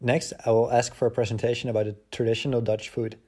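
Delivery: usual speaking voice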